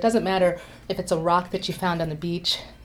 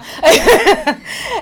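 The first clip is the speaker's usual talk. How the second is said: high squealing laugh; high burst of laughter; 14 semitones higher, 8 dB louder than usual. Real, not acted. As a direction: let a bright laugh burst out. Laughs at own early Portuguese.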